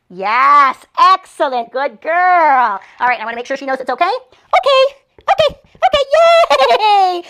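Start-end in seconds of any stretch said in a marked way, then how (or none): 0.1-2.8 shower the puppy with delighted praise; over-the-top happy praise, louder; excited puppy praise. Real, not acted. 4.0-7.2 very high, loud sing-song okays; squealy high release cue with a cheer; excited release call. Real, not acted. Releasing puppy from crate.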